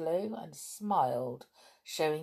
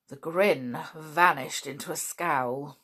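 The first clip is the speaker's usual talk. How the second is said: usual speaking voice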